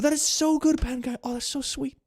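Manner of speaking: high, gushing delight. Real, not acted. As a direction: squeal with joy at the gift. Delighted reading of viewer message.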